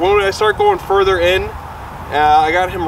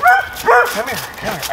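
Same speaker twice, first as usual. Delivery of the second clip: high calling voice; 7 semitones higher, slower than usual. Real, not acted. Calling the dog.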